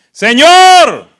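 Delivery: loud, high drawn-out shout; shouted cry. Acted. Blind men crying out to Jesus, shouted. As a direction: cry out at full voice.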